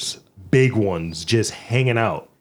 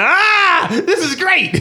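high, loud mock squeal of delight; hammed-up excitement, breaking into laughter; 4 dB louder, much slower than usual. Retelling his reaction to the sweet tea, high mock delight.

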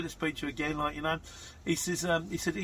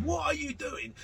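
indignant local; 13 semitones higher than usual. Retold or imitated. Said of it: "like, near a school going".

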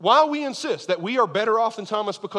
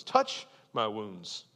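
slow gentle invitation; 8 semitones lower, 5 dB quieter, much slower than usual. Acted. Jesus inviting Thomas to touch.

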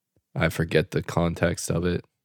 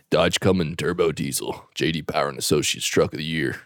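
deep announcer voice for a truck ad; lower, fast ad-read patter; a hint of a truck commercial announcer; mock truck ad; 5 semitones lower, 4 dB quieter than usual.

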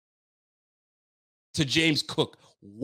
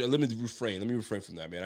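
much softer and lower, reining himself in; holding back; 7 semitones lower, 9 dB quieter, faster than usual. Real, not acted. Fan stopping himself from cursing on camera, low restrained murmur. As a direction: swallow the curse, drop to a low murmur.

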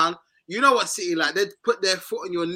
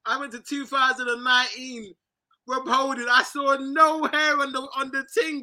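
ordinary talk

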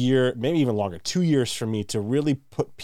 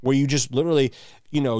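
normal talking voice

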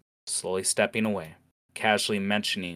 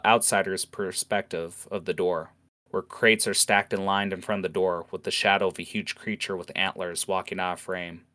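usual speaking voice

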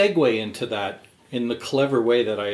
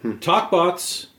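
show intro; 7 semitones higher, 5 dB louder than usual. Acted. Show name announced.

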